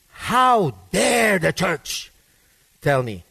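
slow, drawn-out offended protest; mock indignation. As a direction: stretch out the words in mock offense. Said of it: "And she says".

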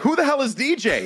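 high-pitched imitation of baffled listeners; mock bafflement. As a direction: squeak it out as incredulous complainers. Retells baffled listeners' reaction.

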